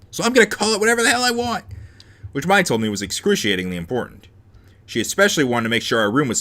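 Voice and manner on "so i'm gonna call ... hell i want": high, fast, exasperated declaration; defiant rant. Real, not acted. Reader done with pronunciation rules, defiant high rant. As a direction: rattle it off high, refusing correction.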